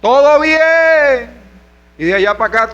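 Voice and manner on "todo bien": high, very slow drawn-out call; long, raised holler. Retold or imitated. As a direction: shout the question across a distance, stretching each word. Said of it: "estás gritando".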